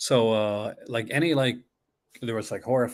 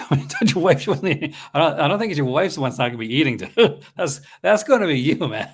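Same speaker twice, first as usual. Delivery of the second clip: laughing and teasing, a bit higher; laughing tease; 4 semitones higher, 5 dB louder than usual. Real, not acted. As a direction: poke fun through laughter.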